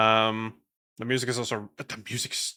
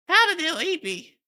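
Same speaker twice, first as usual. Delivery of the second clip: much higher, louder squeak; squeaky put-on voice; 5 dB louder than usual. Streamer voices own late-night sleepiness.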